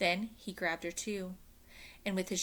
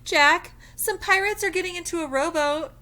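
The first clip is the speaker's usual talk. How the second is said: urgent warning; 11 semitones higher, 11 dB louder than usual. Acted. Annie urgently warns Jack.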